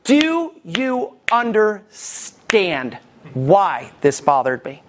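drawn out word by word, very slowly; wife's pointed question. Retold or imitated. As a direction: stretch each word to make sure it lands. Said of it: "Bree always says this".

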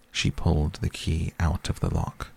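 ordinary delivery

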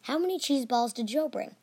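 plain reading voice

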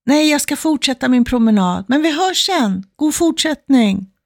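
acted call, high and singing out; cheerful calling back. Walker turning down her neighbour's coffee invitation, calling back. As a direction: call back high across the way, stretching the farewell. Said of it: "Så hon ropar".

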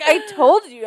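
high, loud, through laughter; laughing gloat. Retold or imitated. Retelling a told-you-so.

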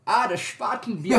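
put-on Spaten said Shpaten in a creepy German accent. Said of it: "do it in your creepy German accent".

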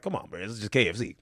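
low, soft retold brush-off; dismissive. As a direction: play it down, low and quiet. Retelling his own dismissive reply.

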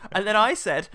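usual speaking voice

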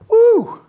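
high loud woo of mock grief; hammed-up cry out; a sudden high whoop of fake despair; mock wail.

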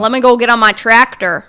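loud mock Plattsburgh accent. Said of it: "The rednecks of upstate?".

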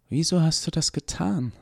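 wondering Eva. A narrator's reading. Eva asks the doctor why he did it, wondering. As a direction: ask it as Eva, genuinely puzzled. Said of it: "fragte sie verwundert".

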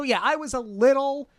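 normal talking voice

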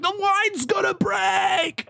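high, drawn-out imitated warning; hammed-up alarm, stretched slowly; mock panicked cry. Voicing boat driver's panicked warning.

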